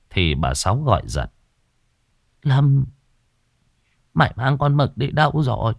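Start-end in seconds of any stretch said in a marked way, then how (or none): 2.4-5.7 put-on high, worried old woman's call; old mother calling out. Old mother demands where son took the dog, high voice.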